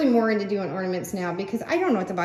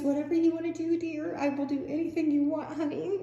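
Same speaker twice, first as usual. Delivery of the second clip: high, sugary simpering imitation; high mock lovey-dovey voice; mock doting boyfriend; 7 semitones higher than usual. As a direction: mimic the whipped boyfriend sweetly. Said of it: "he goes".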